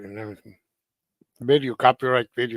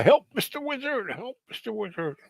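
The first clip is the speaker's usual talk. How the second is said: cartoon turtle in distress; 10 semitones higher than usual. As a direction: mimic a turtle's high plea, trailing away. Replaying cartoon turtle's cry for help.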